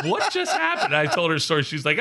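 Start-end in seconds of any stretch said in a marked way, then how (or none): 0.1-1.0 very high-pitched mock bewilderment; imitated friend. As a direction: squeal the question as the baffled friend.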